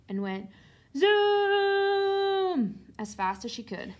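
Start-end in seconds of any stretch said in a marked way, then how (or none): 1.0-2.8 very long, high, loud zoom; full-speed zoom. A narrator's reading. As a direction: hold the zoom like a racing engine, then drop. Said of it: "into ninety-second gear".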